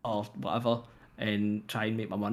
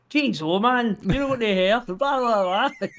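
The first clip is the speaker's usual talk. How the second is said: high, loud hammed-up disbelief; mock shocked onlookers; 10 semitones higher, 7 dB louder than usual. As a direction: ham up the gawping bystander, pitched way up. People quoted mocking baldness.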